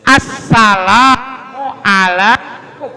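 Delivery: "Assalamualaikum" drawn out slowly, laughing; correct salam with a laugh. Shown as a demonstration. Preacher laughing while modelling the full salam. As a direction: stretch out "Assalamualaikum" fully with a chuckle. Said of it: "Pakai alif lam".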